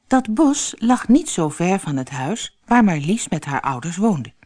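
usual speaking voice

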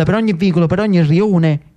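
ordinary delivery

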